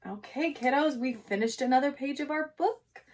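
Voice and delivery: ordinary talk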